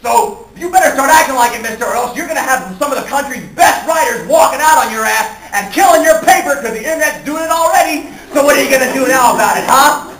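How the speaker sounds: high, loud, brassy dressing-down; Lois Lane. Acted. Reporter confronting her bullying publisher, brassy and defiant. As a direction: stand up to the boss at full volume. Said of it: "out comes brassy, ballsy Lois Lane".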